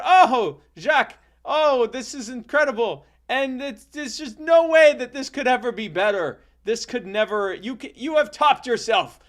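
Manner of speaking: high, loud hammed-up amazement; gushing thanks, acted. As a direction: gush with overdone gratitude. Acts child's gushing thanks.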